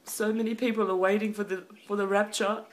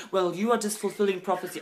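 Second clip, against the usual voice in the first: normal talking voice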